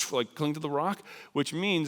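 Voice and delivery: plain reading voice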